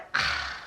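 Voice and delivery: a short put-upon sigh, retold; annoyed sigh. Nurse asked for tea, annoyed sigh. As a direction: sigh as if asked one favour too many. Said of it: "and she was like".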